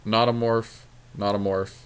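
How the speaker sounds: usual speaking voice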